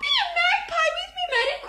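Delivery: very high, warbling bird squawk; magpie. Retold or imitated. Woman playing magpie for a laughing girl, high squawk. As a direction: screech it like a carolling magpie. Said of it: "me being a cockatoo and a magpie".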